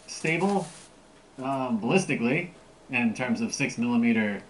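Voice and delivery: muffled behind full face mask; speaking through a gas mask. Real, not acted. Seen 1: Full gas mask on the face in every frame; the reference frames show it only hand-held.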